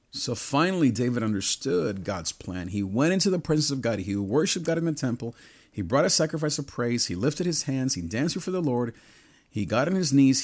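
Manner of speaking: fired-up sermon climax, each line hitting harder; full-force preaching. Acted. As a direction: preach it, rising to a shout of praise. Preaching climax, building fervor.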